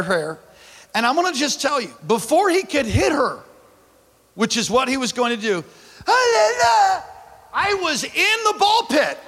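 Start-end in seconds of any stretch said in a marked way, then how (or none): 6.1-9.2 excited shout. Real, not acted. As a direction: shout it out in disbelief. Excited climax of ball pit story.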